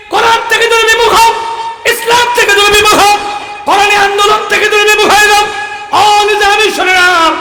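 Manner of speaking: high, loud repeated demands; proclaimed ultimatum. Retold or imitated. Nizami is told to turn from the Quran, high proclaimed demand. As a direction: drive each demand home at full voice. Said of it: "ঘোষণা দিল".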